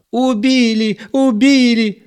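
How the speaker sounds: very high, loud frantic shriek; panicked shrieking. A narrator's reading. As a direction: shriek in alarm. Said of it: "заполошно кричала Зоя".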